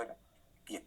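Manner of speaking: normal talking voice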